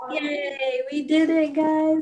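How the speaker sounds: cheering, pitch climbing; happy yay. Real, not acted. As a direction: cheer the win with the group. Celebrating finishing the jeepney.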